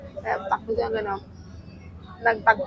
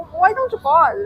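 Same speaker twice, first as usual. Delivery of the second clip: eager urging; 8 semitones higher, 7 dB louder than usual. Retold or imitated. Retelling friend's urging.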